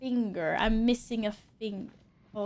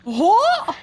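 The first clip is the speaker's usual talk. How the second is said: startled; 9 semitones higher, 9 dB louder than usual. Real, not acted. Sudden shock in game.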